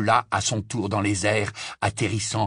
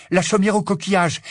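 urgent cry; 8 semitones higher, 4 dB louder than usual. A narrator's reading. Harry calls destination.

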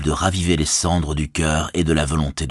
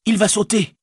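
shouted warning. Acted. Sam warns Frodo.

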